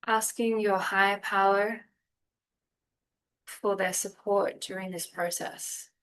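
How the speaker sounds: unhurried meditation guide with long pauses; calm, spacious guiding voice; guided meditation. Real, not acted. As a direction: ease them gently through breath and body, phrase by phrase.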